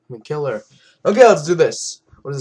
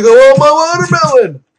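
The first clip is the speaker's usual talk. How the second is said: much higher, louder put-on voice; high, loud voicing of the in-game character; mock character voice; 13 semitones higher, 14 dB louder than usual. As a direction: squeal it out as a caricature. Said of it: "He's like".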